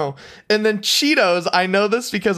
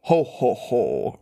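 a deep, dropping giant's "Ho, ho, ho"; mock giant laugh, going low; 8 semitones lower, slower than usual. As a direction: boom it low like a jolly giant. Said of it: "in a really deep voice".

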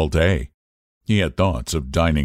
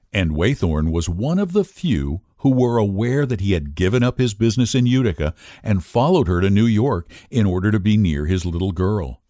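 ordinary delivery

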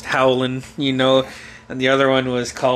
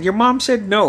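higher, louder retold scolding; imitated stern dad; 9 semitones higher, 5 dB louder than usual. Dad breaking silence to back mom up, mock stern. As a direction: lay down the law as an acted dad.

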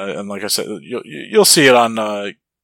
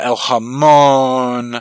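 drawn out; 2 semitones higher, 4 dB louder, much slower than usual. Pretend. Drawn-out hashtag name.

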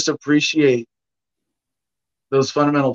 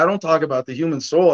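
ordinary talk, nothing marked

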